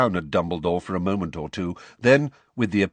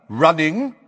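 high, loud, rising challenge; sharp query; 9 semitones higher, 7 dB louder than usual. Acted. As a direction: throw the word back sharply. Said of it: "said Madam Bones sharply".

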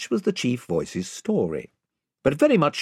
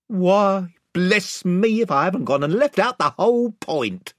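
a lightly voiced flustered country chief; the chief catching his slip; 7 semitones higher, 3 dB louder than usual. A narrator's reading. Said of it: "said the chief voice".

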